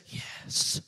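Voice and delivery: put-on little boy's hushed whisper. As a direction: whisper secretly into the phone. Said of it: "came back on the phone whispering".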